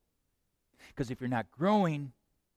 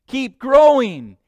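much louder and higher, slow "Keep growing"; booming command; 9 semitones higher, 16 dB louder, slower than usual. Acted. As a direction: hammer it slowly at full voice. Preacher drives the command home.

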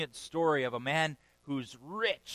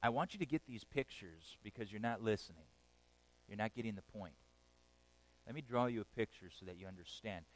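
softer, lower patient explaining; patient God; 4 semitones lower, 8 dB quieter than usual. Retold or imitated. God pleading softly with people not listening. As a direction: coax them gently to understand. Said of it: "and God says".

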